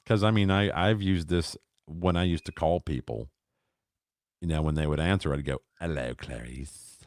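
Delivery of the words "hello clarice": low, slow, soft creepy greeting; slow sinister phone hello; Hannibal Lecter voice. Retold or imitated. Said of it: "Like that".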